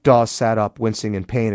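ordinary delivery

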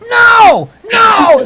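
very high, loud mock wail; frantic denial. A narrator's reading. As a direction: cry out in hammed-up denial. Character covers ears in denial.

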